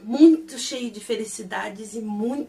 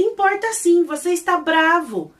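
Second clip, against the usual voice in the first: insistent contradiction; 6 semitones higher, 5 dB louder than usual. Retold or imitated. Acted quarrel, insisting.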